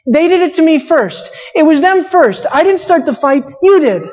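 put-on high, petulant little-boy whine; whiny little boy. Preacher mocks Samson as a whiny little boy. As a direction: whine like a kid blaming someone. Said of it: "Sounds like a little boy".

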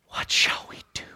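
hushed, whispered question; whispered plea. Acted. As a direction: ask it in a whisper. Crowd asks Peter, hushed.

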